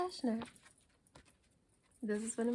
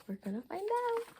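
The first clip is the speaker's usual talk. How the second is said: teasing; 10 semitones higher than usual. Acted. Reader teases kids.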